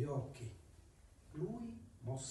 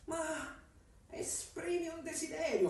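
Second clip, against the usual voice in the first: high, slow purring cat voice. Acted. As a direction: speak as a sly talking cat. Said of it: "fece quella voce gattesca".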